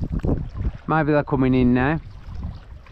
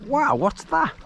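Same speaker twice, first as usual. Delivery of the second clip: surprised; 9 semitones higher, slower than usual. Retold or imitated. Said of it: "I was like".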